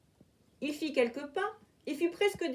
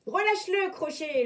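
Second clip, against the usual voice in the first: higher, louder threat; threatening; 7 semitones higher, 5 dB louder than usual. A narrator's reading. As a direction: order him to let go. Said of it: "le menaça Peter Pan".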